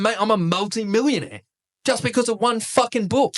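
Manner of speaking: high-pitched, emphatic amazement; retold triumph. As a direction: build to a high, incredulous punchline. Retold millionaire's triumphant punchline.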